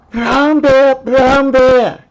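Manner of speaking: high, loud calling-out princess voice; calling the bear. Acted.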